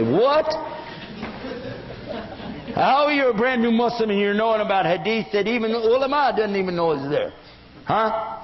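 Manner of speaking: high-pitched disbelieving challenge; acted "oh come on" questioning; mock incredulity. Acted challenge to a fake convert.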